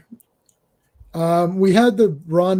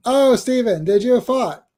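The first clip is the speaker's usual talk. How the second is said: higher, swooping cartoon Canadian voice; Terrence and Phillip; 6 semitones higher than usual. Host as cartoon Canadian teasing Steven, hammed-up silly voice. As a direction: play it as a silly cartoon double act. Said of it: "My favorite Terrence and Phillip".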